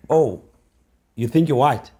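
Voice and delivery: loud sneering taunt, pitch up; mock taunt. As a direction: jeer it like the class bully. Bully taunting in a retold story.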